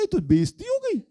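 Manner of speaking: high, sarcastic gasp of outrage; sarcastic mock outrage at the son's complaint; mock shock. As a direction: exaggerate the insult to tease. Mocking son's sense of insult.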